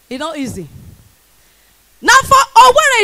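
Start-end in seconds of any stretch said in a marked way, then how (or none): 2.0-3.0 much higher and louder, hyping the crowd; big loud opener. Acted. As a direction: launch it at the room.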